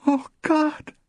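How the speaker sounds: high, loud cry of horror; a high, loud gasp of horror; horrified cry. A narrator's reading. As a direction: cry out in shock to God.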